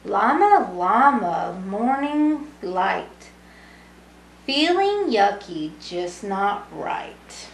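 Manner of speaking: gentle storybook read-aloud, lilting rhymes; reading to children. Acted. Teacher reading a bedtime book to young kids, lilting. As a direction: read it like bedtime for little ones.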